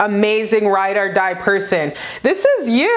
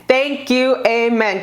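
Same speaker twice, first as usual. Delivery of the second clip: ordinary delivery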